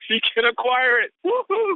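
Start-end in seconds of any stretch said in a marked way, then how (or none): 1.2-1.8 high exultant woo-hoo; hype-man whoop; cheer. Real, not acted. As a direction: throw your voice up in joy. Cheering after quote.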